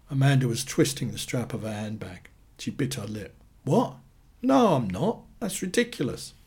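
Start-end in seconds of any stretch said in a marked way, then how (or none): 3.6-6.3 high-pitched, incredulous protest; pitched well up, protesting; indignant denial. A narrator's reading. Amanda denies the affair to Annie, indignant.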